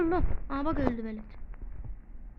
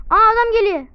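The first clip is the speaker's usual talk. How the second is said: sudden loud high warning; real shock at the enemy coming; startled alarm; 7 semitones higher, 11 dB louder than usual. Real, not acted. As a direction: blurt it out, caught off guard.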